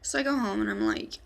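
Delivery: ordinary delivery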